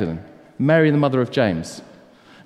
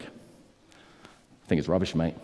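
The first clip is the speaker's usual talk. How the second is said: slow, lower and much softer, a sneering put-down; cynical dismissal; 8 semitones lower, 8 dB quieter, much slower than usual. Acted. The second person chopping the first down, dismissive sneer. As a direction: drawl it out like a cheap shot. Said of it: "They've been a cynic".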